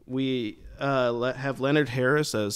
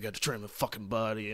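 imitating Travis; 8 dB quieter, faster than usual. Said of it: "and he was like".

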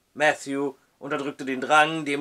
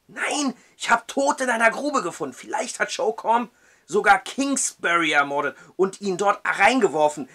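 high, urgent insistence; insistent; 5 semitones higher, 4 dB louder than usual. Acted. Matthew insists to the judge, urgent. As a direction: plead to be believed.

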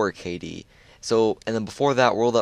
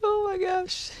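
squeaky high laughing, words breaking apart; can barely get words out through laughter; real giggling fit; slower than usual. Real, not acted. Streamer sees a huge unlock and laughs uncontrollably, high squeals. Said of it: "I don't know why I'm laughing".

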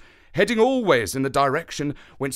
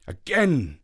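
ordinary delivery, nothing marked

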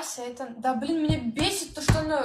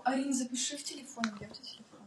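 fast, softer whisper to someone nearby; 7 dB quieter, much faster than usual. Real, not acted. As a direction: hurriedly whisper a request. Asks friend quietly mid-call.

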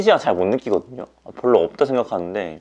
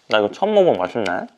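ordinary delivery

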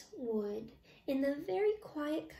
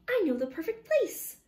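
high, louder little squeak; little mouse squeaking helpfully to foxes; squeaky mouse; 7 semitones higher, 5 dB louder than usual. Acted. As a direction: pipe it up like an eager mouse. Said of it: "squeaked the mouse".

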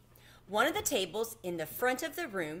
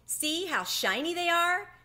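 excited; 10 semitones higher, 4 dB louder than usual. Acted. Showing off new shoes.

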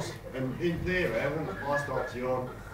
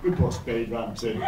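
ordinary delivery

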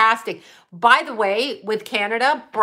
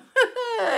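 high squeal of laughter; a sharp gleeful cackle; 13 semitones higher than usual. Real, not acted. After bragging about haters.

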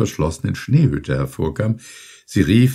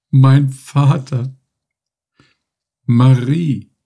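higher, louder, very slow call; weak joyful cry; 6 semitones higher, 5 dB louder, much slower than usual. A narrator's reading. Dying son greeting father and Marie, slow and raised. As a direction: stretch each name out in wonder. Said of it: "rief Ludwig Kornbütt".

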